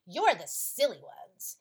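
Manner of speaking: very loud angry yell; furious shout. Acted. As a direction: explode at the children in a rage. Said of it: "he shouted at the children".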